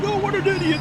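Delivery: high-pitched mock yell; mock scream. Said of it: "be the guy screaming".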